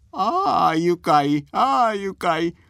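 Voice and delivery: put-on high, slow gleeful old man; delighted old man. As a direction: savour it like a tickled grandpa. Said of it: "大喜びのおじいさんの声".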